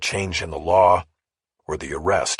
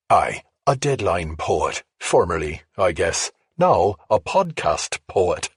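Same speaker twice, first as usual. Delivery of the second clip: a lightly put-on Irish lilt, slowed down; half-hearted Irish accent, jokingly tossed off; weak Irish accent; slower than usual. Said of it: "My Irish accent wasn't cutting it".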